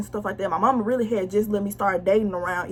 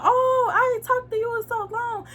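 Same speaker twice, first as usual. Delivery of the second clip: much higher, sugary mock sweet-talk; mocking boyfriend; 13 semitones higher than usual. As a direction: imitate him cooing on the phone.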